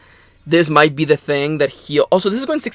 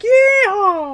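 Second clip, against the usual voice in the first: much higher cowboy whoop; cowboy yeehaw. Acted. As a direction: holler like a cowboy. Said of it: "being all like".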